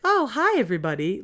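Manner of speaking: high, lively hello; bright cheerful greeting. Shown as a demonstration. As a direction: greet everyone with big energy. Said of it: "Like, I just couldn't do that".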